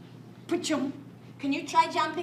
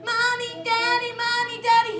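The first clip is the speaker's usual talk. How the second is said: higher sing-song calling; cub calling parents; 6 semitones higher, 5 dB louder than usual. Acted. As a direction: call out for mum and dad, higher. Cub runs home calling parents.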